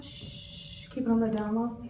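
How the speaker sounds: whispered shh, then hushed; hushed secret. Retold or imitated. Shopper whispering to cashier, hushed and secretive. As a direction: keep it down, conspiratorial. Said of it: "and you're all".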